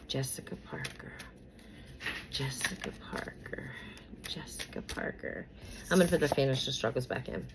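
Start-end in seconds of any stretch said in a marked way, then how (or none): 0.1-5.5 whispered incantation. Real, not acted. Reader whispers the celebrity's name over the tarot deck. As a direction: whisper the name, much softer.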